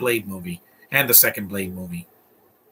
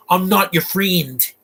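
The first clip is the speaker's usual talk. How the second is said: mocking quote; 6 semitones higher, slower than usual. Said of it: "Yeah, that's him".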